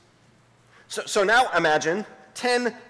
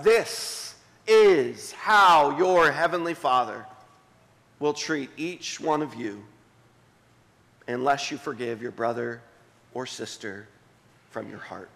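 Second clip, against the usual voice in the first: solemn scripture quote; 7 semitones lower than usual. Acted. Reads Jesus' words solemnly.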